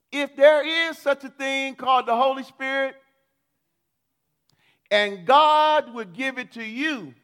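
loud, slow indignant rant; shouting rant. Retold or imitated. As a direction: shout it at God in protest. Retold mocking rant at believer.

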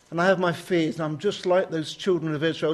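ordinary delivery

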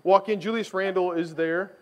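ordinary talk

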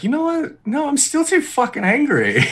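high, indignant mock rant; a hammed-up furious refusal to die; mock angry Gaius. Gaius cut in half, refusing to die, mock furious. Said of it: "he'll be like".

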